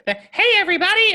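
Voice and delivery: very high, loud squeaky greeting; squeaker kid. Acted. Kid jumping on the game mic to greet everyone, squeaker voice. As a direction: pipe up like an overexcited kid gamer. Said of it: "a squeaker is a guy who jumps on".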